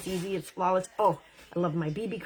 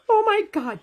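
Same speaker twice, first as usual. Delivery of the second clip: loud, high burst of excitement; excited burst; 12 semitones higher, 7 dB louder than usual. Real, not acted. Vlogger thrilled by a new primer, loud excited exclamation. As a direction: blurt it out, thrilled. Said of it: "Sorry, I just got so excited".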